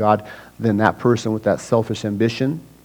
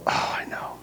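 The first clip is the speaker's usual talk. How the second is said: whispered I know; 12 dB quieter than usual. Acted. Dennis already convinced.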